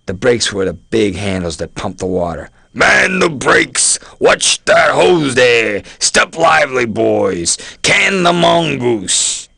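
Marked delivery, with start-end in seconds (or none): 2.7-9.5 slow, high shouted fire orders; combat callout. A narrator's reading. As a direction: bellow it like a fire marshal. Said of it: "shouted orders to everybody through a silver-speaking trumpet".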